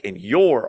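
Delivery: "your" punched in all caps; emphatic "your", said as if capitalized; stress on YOUR. Shown as a demonstration. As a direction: hit "your" hard, with a pitch peak. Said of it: "all caps".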